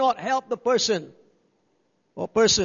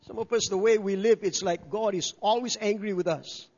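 normal talking voice

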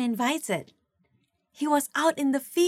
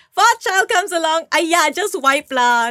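careless parent; 5 semitones higher, 6 dB louder than usual. Retold or imitated. Careless fourth-child parent.

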